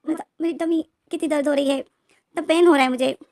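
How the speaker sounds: groaning out a pregnant belly ache; in pain. Acted. Wing alone at the mirror, moaning in pain. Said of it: "जोरों से सांसें ले रहा था".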